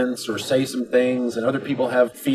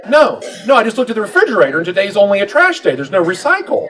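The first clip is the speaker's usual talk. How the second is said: wife explaining; 7 semitones higher, 4 dB louder than usual. Retold or imitated. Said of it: "She's like".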